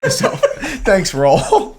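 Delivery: can't keep a straight face; laughing retold reply; laughing through quote. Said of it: "he was just like".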